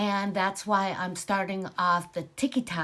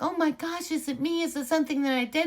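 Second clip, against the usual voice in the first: high, anxious rushed pleading; a voice pitched way up, panicky and fast; worried friend; 8 semitones higher, faster than usual. Retold or imitated. Upset friend fears she caused it.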